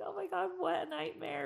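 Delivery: lightly hammed-up horror; mock dismay. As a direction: groan it like a homeowner at wits' end. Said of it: "and I was like".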